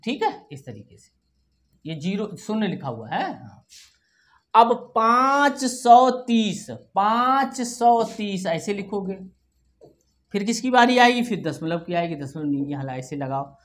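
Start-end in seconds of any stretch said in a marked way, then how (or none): 4.9-8.5 slow, stretched number words; dictation. Shown as a demonstration. Teacher dictating a number to children, slow dictation. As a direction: draw out each word so it can be written.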